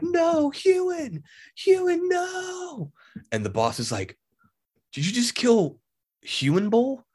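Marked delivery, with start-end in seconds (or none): 0.0-2.9 slow, much higher hammed-up wail; mock anguished cry. Hero's friend killed, retold cry. 4.9-7.0 higher, incredulous villain question; mock shocked boss. Boss reacting to killing.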